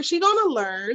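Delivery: ordinary talk, nothing marked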